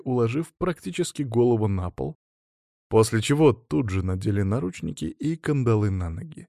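ordinary delivery

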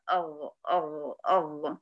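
أغ low and soft, from the tongue; wrong غ. Shown as a demonstration. Teacher shows the mistake most make. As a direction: say أغ the common wrong way. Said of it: "معظمنا بيغلط فيه يقول أغ".

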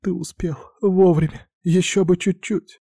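high tearful woman's voice; sobbing. A narrator's reading. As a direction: choke it out through sobs. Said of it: "плакала она навзрыд".

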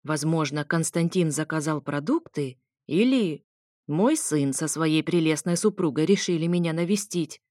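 ordinary delivery, nothing marked

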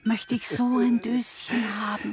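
breathy covetous whisper; whispered admiration of the box; admiring whisper. Acted. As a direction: hush with longing. Coveting the snuffbox.

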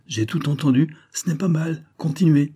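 high, lively voice of a director; exclaimed approval. A narrator's reading. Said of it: "s'écria monsieur Paul".

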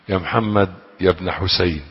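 usual speaking voice

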